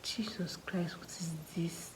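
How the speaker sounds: soft whispered dismay; hushed panicked whisper. Retold or imitated. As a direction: whisper it in shock. Blanking out in exam.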